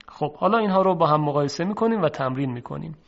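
ordinary talk, nothing marked

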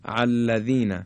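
الذین opened with a squeezed pharyngeal ayn; hamza as ayn. Shown as a demonstration. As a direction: start الذین from the throat as if with ع. (الذین با همزه مثل عین)

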